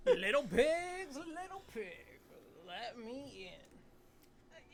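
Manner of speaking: slow, creepy big bad wolf; menacing wolf voice. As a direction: drag it out like a villain.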